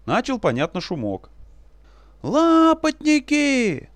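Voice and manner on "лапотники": very high, drawn-out taunting yell; straining yell. Acted. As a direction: bellow it through cupped hands. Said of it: "надрывался он, сложив руки воронкой".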